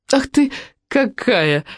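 high, drawn-out mock scolding; playful reproach. A narrator's reading. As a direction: tease mother as if catching her out. Daughter playfully scolds her mother.

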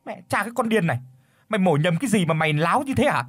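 big man scolding the woman after grabbing her cleaver; angry scolding. Acted. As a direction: bark at her to calm down. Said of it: "rồi quát".

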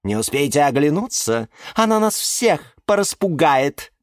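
high, snide tirade; high-pitched scornful complaint; spiteful tattling. A narrator's reading. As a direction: pile on accusations maliciously. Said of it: "ехидно поддакнула".

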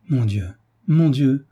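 a touch of moved rapture; tearful enthusiasm. A narrator's reading. Frédéric crying out to God, with tearful enthusiasm. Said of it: "avec enthousiasme et les yeux mouillés de douces larmes".

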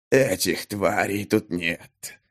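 slow, low and soft, a menacing man; unpleasant male voice. A narrator's reading. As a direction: drawl it slowly and coldly. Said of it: "Неприятный мужской голос".

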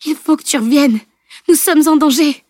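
loud, high desperate plea; alarmed, pleading cry; imploring. A narrator's reading. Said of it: "implora Camille".

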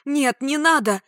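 high, loud plea; frightened cry. A narrator's reading. Woman grabbed by attacker, crying out. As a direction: cry out in alarm, pitch jumping up. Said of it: "отчего я вскрикнула".

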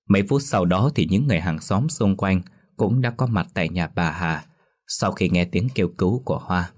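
ordinary delivery, nothing marked

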